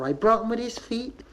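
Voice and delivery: high questioning Bristol lilt; statement that rises like a question; mother at the clinic, high rising Bristol voice. Retold or imitated. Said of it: "They usually go and say things like".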